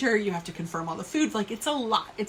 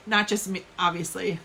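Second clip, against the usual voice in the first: normal talking voice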